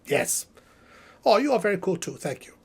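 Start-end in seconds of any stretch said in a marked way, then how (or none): none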